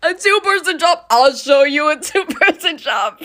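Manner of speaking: loud, indignant echo of a quote; mock outrage. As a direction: throw it back as a mock threat. Indignantly throws quoted phrase back.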